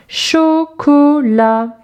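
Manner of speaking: drawn-out teacher's demonstration; syllable by syllable. Shown as a demonstration. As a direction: chant out "Chocolat" in three long beats. Syllable exercise, picture word.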